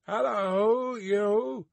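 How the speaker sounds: high sharp greeting shout; guard's hail. A narrator's reading. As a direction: hail a rider from afar. Guard hails the rider.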